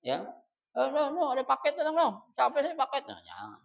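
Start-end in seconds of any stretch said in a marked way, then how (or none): 0.7-3.0 high-pitched, urgent calling through the house; raised-pitch nagging call; mock calling out. Shown as a demonstration. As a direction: imitate a spouse hollering about a delivery. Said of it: "manggil teriak-teriak".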